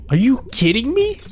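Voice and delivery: high, incredulous cry; stunned, can't believe it; shocked disbelief. Real, not acted. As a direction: jump up in pitch in sheer disbelief. Shocked at sharing the name.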